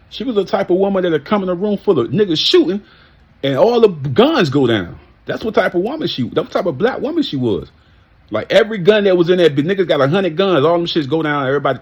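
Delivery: high, loud, worked-up praise; impassioned. Real, not acted. Man telling how her entrance disarmed a room, impassioned. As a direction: build the tribute with high, forceful excitement.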